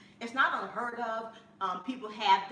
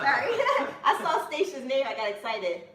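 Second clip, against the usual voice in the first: giggly apology, loud and high; laughing; 6 semitones higher, 9 dB louder than usual. Real, not acted. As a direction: laugh through the words. Laughs after excited greeting.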